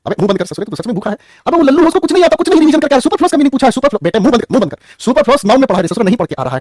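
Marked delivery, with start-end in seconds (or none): 1.5-4.8 much higher and louder teasing rant; mock scolding. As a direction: rag on the student loudly, half in jest.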